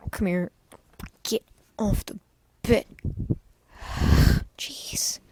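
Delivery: hushed whisper aside; real whispered aside to a pet; whispering to the cat. Real, not acted.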